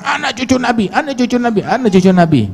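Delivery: very high, insistent mock chant; mock boasting shrieks. Preacher mimics today's people flaunting their lineage, mock boasting shrieks. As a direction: squeal the boast over and over, mocking. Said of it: "Beda sama orang sekarang".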